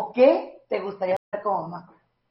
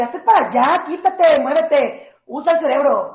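loud, raised volley of orders; mock angry scolding; 4 semitones higher, 6 dB louder than usual. God imagined pushing a person away, loud mock scolding. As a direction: imitate an impatient parent ordering someone off.

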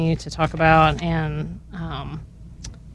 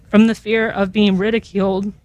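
ordinary talk

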